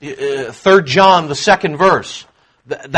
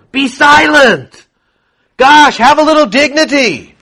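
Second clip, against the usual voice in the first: higher-pitched, exclaimed hushing; scolding rebuke; 7 semitones higher than usual. Acted. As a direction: shut him up like an indignant crowd. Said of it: "it means to be silent".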